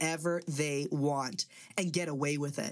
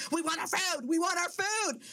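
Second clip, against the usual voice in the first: mimicking kids yelling; 11 semitones higher than usual.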